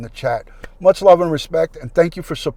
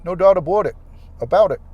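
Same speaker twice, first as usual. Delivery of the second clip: mock Canadian accent; 4 semitones higher, 6 dB louder, faster than usual. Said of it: "Canadian accent down".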